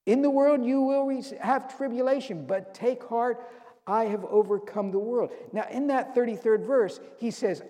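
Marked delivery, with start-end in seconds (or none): 0.1-5.3 higher, louder preaching climax; fervent preaching. Acted. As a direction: lift it up like a rallying cry.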